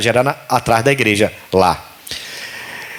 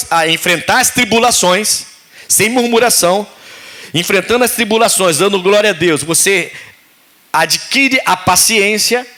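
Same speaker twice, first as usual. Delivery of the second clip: raised, fervent preaching voice; 5 semitones higher than usual. Acted. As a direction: preach it to the congregation. Pregação em culto. (pregação fervorosa ao povo)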